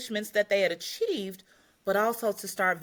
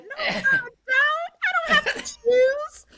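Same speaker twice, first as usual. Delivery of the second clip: very high, girlish mock protest, slowing and getting louder; playful high-pitched whine of refusal; inner girl protesting; 6 dB louder, slower than usual. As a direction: squeal it like a hammed-up little girl refusing, laughter breaking in. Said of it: "the girl in me said".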